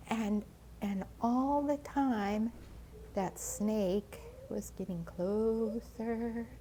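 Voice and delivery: slow and hushed, drawing it out; slow suspense. A narrator's reading. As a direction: let the danger creep closer. Snake creeping toward mouse.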